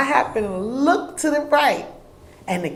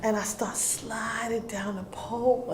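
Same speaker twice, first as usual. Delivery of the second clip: usual speaking voice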